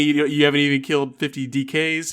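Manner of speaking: imitating a streamer's scolding roast; mock roast. Co-host imitating the streamer roasting an account, mock scolding. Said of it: "He'd be like".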